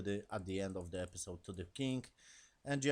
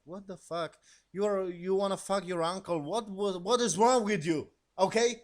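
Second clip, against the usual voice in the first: loud, high, incredulous rant; outraged disbelief; 8 semitones higher, 7 dB louder than usual. Real, not acted. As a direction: blow up at her in disbelief. Outraged reaction to the niece.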